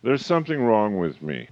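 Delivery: ordinary delivery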